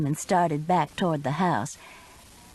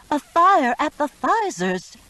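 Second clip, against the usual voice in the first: alarmed; 8 semitones higher, 6 dB louder than usual. A narrator's reading. Alarm to daughter.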